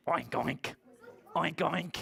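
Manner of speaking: oink like a storybook pig. Acted. Puppy George answering the vet, pig oinks. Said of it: "and George went".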